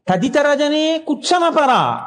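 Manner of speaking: slowly intoned Sanskrit phrase; slow verse quote. Acted. Preacher closing with the verse line, slow chant. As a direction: draw the verse out deliberately.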